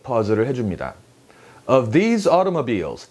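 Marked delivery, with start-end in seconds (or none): 1.7-3.1 "these" drawn out in "Of these automobiles"; slow phrase with a lengthened "these"; long THESE. Shown as a demonstration. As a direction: stretch the vowel of "these". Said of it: "these를 길게 뽑아주세요".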